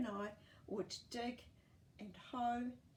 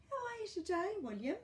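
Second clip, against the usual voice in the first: put-on much higher, louder sweet old lady; old Edna, sweet; 11 semitones higher, 4 dB louder than usual. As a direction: greet the boy like a kindly grandma. Old Edna greets the boy.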